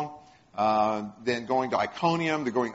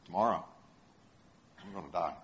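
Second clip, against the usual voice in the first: low, hushed inner voice of a doomed man; hushed despair; 8 semitones lower, 9 dB quieter, faster than usual. Retold or imitated. Peter in his cell, fearing tomorrow, hushed and low. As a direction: murmur it like a prisoner facing death.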